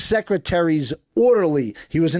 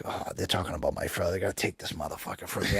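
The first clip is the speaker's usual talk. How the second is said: low, much softer worried muttering; paranoid inner thought; 10 semitones lower, 12 dB quieter than usual. Retold or imitated. Prisoner watching cops in the yard, muttering paranoid thoughts low. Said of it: "I think".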